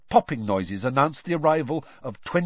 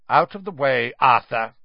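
curt, a touch louder; cold and curt; 5 dB louder than usual. A narrator's reading. Official brushing Arthur aside, cold and curt. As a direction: dismiss him coldly. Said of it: "said a cold, curt voice".

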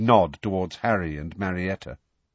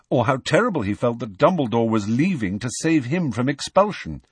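ordinary delivery

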